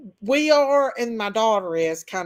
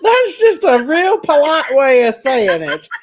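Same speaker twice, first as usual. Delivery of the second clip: loud, higher voice through real laughter; laughing; 4 semitones higher, 8 dB louder than usual. Real, not acted. Host reacting to caller's punchline, laughing loudly. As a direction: say it while cracking up.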